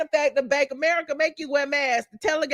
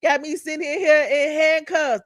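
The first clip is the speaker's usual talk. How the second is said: normal talking voice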